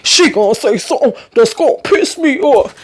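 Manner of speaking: high, tense and worked up; agitated. Retold or imitated. Gary fretting to Aaron before answering, high and tense. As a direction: voice him winding up before the call.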